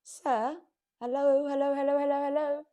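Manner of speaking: loud, high repeated hellos; exasperated hello. Retold or imitated. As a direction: knock sense into him, calling hello. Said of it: "I'm like".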